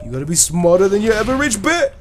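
high, loud cartoonish line; playful loud put-on voice; mock catchphrase. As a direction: crow it high, rising at the end.

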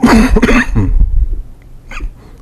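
coughing fit. Real, not acted. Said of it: "apologize for my coughing".

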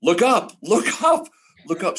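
urgent high yells of look up; frantic producer yelling look up. Retold or imitated. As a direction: shout it up at him, pitch cracking high. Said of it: "And he yells".

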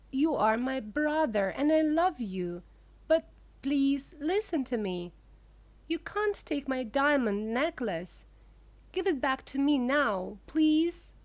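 plain reading voice

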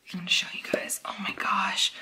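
quick hushed whisper; soft breathy aside. Real, not acted. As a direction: whisper it as you work. Whispering while handling tubes.

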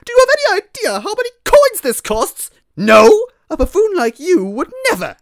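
very high, loud shrieking outrage; over-the-top screamed put-down; furious rant. Acted. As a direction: yell it as a scornful tirade. Furious character berates a buffoon.

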